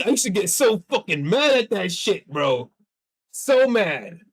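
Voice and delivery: ordinary talk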